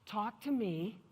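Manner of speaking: soft, measured coaxing; gentle invitation. Retold or imitated. Manager to a crying employee, gently coaxing. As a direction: invite them to open up, softly.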